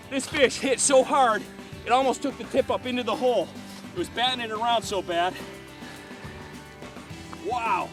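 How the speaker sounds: excited. Real, not acted. Fighting a big fish.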